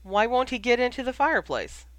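high, loud indignant question; loud bafflement. A narrator's reading. Ron getting up to question Ginny, loudly baffled. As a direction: call it out in baffled protest.

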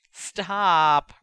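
loud, disgusted protest. A narrator's reading. Brother not wanting to hear about love child, protesting loudly. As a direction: cry out to cut her off. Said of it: "Ron protested".

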